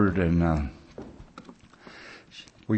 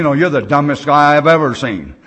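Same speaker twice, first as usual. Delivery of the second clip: louder, higher, exasperated teasing jab at a friend; mock scolding; 4 semitones higher, 6 dB louder than usual. Telling his sponsor in the hole he's dumb, loud mock scolding. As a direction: tell him off with a grin, loud.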